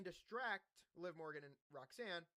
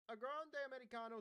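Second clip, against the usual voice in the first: lightly put-on Italian accent, higher pitch; 8 semitones higher, 3 dB louder than usual. Host repeating the wrestler's name for the recap, light Italian accent. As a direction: say the name with a playful Italian lilt. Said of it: "I do an Italian accent".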